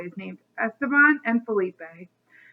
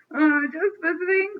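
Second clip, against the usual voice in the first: nervous, higher-pitched storybook reply; gulped; 6 semitones higher than usual. A narrator's reading. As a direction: answer with a nervous gulp. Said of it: "Stanley gulped".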